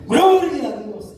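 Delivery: drawn out, high, booming "Gloria a Dios"; mock preacher's cry "Gloria a Dios" pitched high and slowed; imitating the preacher. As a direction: stretch "Gloria a Dios" slow and high like waking the hall. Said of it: "decía".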